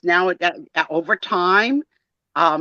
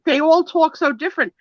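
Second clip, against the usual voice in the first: mock baffled European; 6 semitones higher than usual. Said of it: "Europeans must be like".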